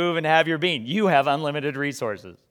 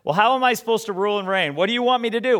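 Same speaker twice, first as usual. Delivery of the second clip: higher and fast, earnest questioning; asking God; 6 semitones higher, 3 dB louder, faster than usual. Retold or imitated. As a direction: pour the questions out quickly, higher. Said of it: "You might as well just ask him".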